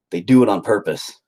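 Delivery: ordinary delivery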